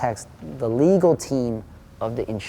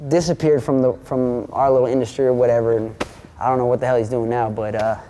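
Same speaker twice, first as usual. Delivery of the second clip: ordinary talk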